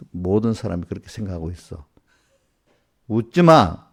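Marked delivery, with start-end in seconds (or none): 3.1-3.8 sharp scolding. Real, not acted. Pastor scolding a laughing congregant.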